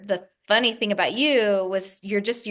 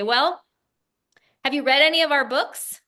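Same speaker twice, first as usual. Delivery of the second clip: a bit higher, quicker, blunt East Coast New Jersey challenge; lightly put-on New Jersey no-nonsense tone; mock New Jersey bluntness; 6 semitones higher, 3 dB louder than usual. Daughter imitating mother's blunt New Jersey phone manner. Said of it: "in this New Jersey voice".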